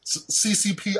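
ordinary talk

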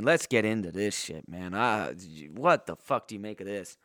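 ordinary delivery

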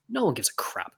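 breathy whisper with no voice; whispered aside. Real, not acted. Whispering about border guards ignoring vaccine rules. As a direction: drop to a whisper to dismiss the rules.

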